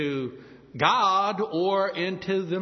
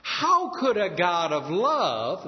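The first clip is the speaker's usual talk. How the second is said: mocking, scornful questioning, slowed; a hint of a smug unbeliever; skeptical challenge; 4 semitones higher, slower than usual. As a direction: throw the objection down like a dare. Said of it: "it sometimes goes like this".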